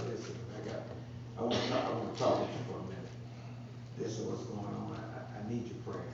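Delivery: hushed confession. Retold or imitated. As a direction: lean in and confess quietly. Confiding in a brother for prayer.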